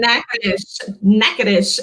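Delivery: Natchitoches said as NACK-uh-dish; Louisiana pronunciation. Shown as a demonstration. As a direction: repeat Natchitoches for learners, ending in "dish". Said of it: "but in Louisiana, it's Natchitoches".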